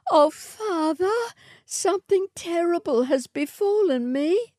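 high distressed plea; alarmed daughter. A narrator's reading. Matilda tells her father of the terrible dream. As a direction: beg father in fright.

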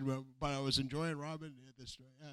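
pained, nearly whispered; hurting, barely audible talk. Real, not acted. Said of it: "My back hurts".

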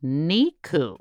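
slow syllable-by-syllable correction, NIKU at the end; correcting the pronunciation. Shown as a demonstration. Correcting the sketch phrase, drawn-out syllables. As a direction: spell out "Kwaki Sir Pi Niku" slowly, syllable by syllable. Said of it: "It's not Kwaki Sir Pi Piku".

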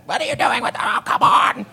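high exasperated mom voice; annoyed mom. Retold or imitated. Mom scolding kid over toys in the furnace.

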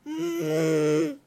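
low trumpeting hum; elephant sound. Pretend. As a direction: rumble like a little elephant. Asked what an elephant sounds like.

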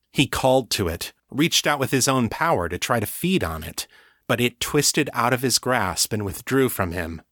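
usual speaking voice

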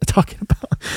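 words dissolving into an amused laugh. Real, not acted. Dad retelling his comeback to his seven-year-old, laughing. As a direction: break into a laugh mid-question.

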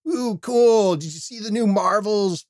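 high-pitched mock fan excitement; put-on high, thrilled squeal of interest; gushing young fan. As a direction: gush like an excited kid fan. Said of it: "go into one of their groups and say".

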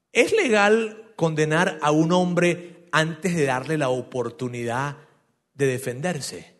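pregunta lenta. Retold or imitated. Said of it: "esto fue lo que dijo".

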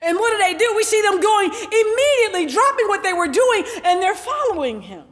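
high, emphatic sermon delivery; preaching. Real, not acted. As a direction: preach it out with stretched key words. Preaches at raised pitch.

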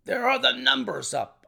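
high-pitched, eager call; excited cry. A narrator's reading. Narrator spotting the numbers at the race, crying out. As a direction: cry out the news. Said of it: "I cried".

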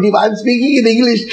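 ordinary talk

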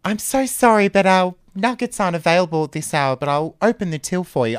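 high, loud and flirty, all breathy charm; sexy shop worker. Acted. Shop worker flirting with the nugget robber, sultry and high. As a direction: purr it like she fancies the robber. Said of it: "I'm doing it so sexily".

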